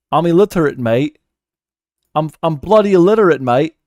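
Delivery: playful British self-mockery; mock British accent. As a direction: joke in a put-on British accent. Jokes in put-on British slang.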